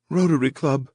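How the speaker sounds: put-on high, choked small girl voice; choked little girl voice. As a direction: squeeze it out like a scared little girl. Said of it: "in a choked little girl voice said".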